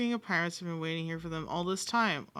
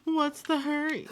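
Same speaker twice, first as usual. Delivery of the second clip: higher mocking echo of villain; imitating game voice; 9 semitones higher, 4 dB louder than usual. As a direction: parrot the taunt back, raised. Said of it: "repeats the game character's line".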